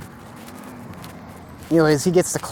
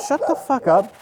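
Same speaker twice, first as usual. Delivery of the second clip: sudden higher irritated outburst at the barking; annoyed snap; 9 semitones higher than usual. Real, not acted. Telling off the barking dogs, irritated outburst. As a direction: cut off the noise mid-story. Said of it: "I can't think with you little bastards barking".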